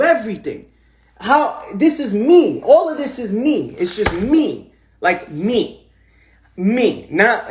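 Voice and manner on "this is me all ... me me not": ordinary talk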